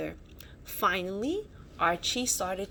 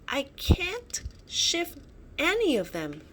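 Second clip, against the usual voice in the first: grunting with effort; 7 semitones higher than usual. A narrator's reading. Archie straining against the rocks blocking the cave, effortful grunt. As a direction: push the words out like lifting rocks. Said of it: "he grunted".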